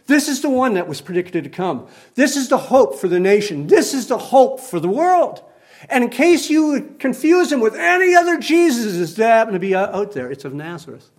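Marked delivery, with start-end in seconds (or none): 2.2-10.1 rising preaching. Acted. Preaching builds.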